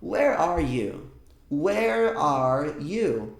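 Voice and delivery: "Where are you?" spoken very slowly, word by word; slow teaching demo; slow model phrase. Shown as a demonstration. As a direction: drill it out slowly for students. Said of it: "Uma das frases mais ouvidas com where".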